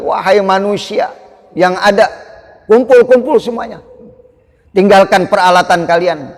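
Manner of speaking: loud, high summons to the crowd; rally everyone to gather; calling out. Acted. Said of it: "Nabi teriak lagi".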